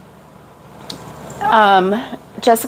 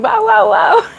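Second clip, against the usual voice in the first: mock alarmed scream; 10 semitones higher than usual. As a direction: mimic screaming awake at her husband.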